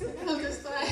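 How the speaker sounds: laughing. Real, not acted. Laughs at herself.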